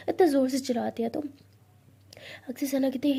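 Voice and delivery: usual speaking voice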